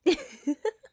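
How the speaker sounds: a playful, flirtatious laugh; bright teasing burst of laughter. Acted. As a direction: laugh at your own cheeky suggestion. Teasing after suggesting her bed.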